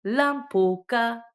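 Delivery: "lampuka" said high, loud and slow; careful slow demo of "lampuka"; example word. Shown as a demonstration. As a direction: draw out "lampuka" syllable by syllable. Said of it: "Per esempio".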